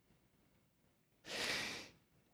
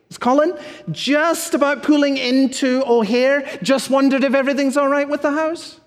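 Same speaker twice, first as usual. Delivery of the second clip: higher, chirpy, fast check-in call; the pastor plays himself fussing at the house sitter, chirpy; fussy phone caller; 9 semitones higher than usual. Retold or imitated. A bright, over-eager caller checking in too soon. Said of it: "I say".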